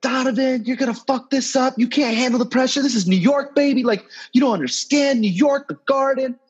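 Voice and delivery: high, taunting courtside heckler yell; heckling fan. Retold or imitated. Fan next to me heckling Donovan, high mocking taunt. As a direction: taunt him like a loudmouth fan. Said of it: "the guy next to me is like".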